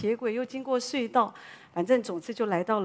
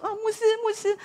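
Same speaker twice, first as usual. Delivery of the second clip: excited calling; 12 semitones higher, 4 dB louder than usual. Retold or imitated. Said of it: "旁边又有四五个人过来说".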